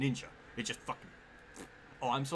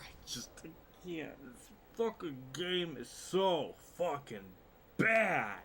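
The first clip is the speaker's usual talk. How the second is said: frustrated rant; 6 semitones higher, slower than usual. Real, not acted. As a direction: whine out the frustration. Raging at the game.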